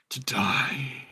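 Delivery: hushed, menacing whisper; whispering beast. Acted. Cerberus finishes its threat to Callista, whispering. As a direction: breathe it out like a monster's threat. Game master voices Cerberus head.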